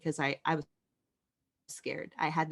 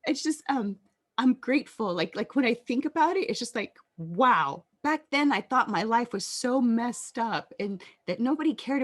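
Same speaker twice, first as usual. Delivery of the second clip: real emotion, higher and louder; emotional; 5 semitones higher, 4 dB louder than usual. Real, not acted. Survivor grateful she was protected back then, emotional. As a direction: overcome with gratitude, voice lifting high. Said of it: "I'm gonna get emotional".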